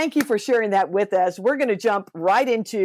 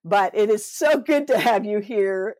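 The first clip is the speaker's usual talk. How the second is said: normal talking voice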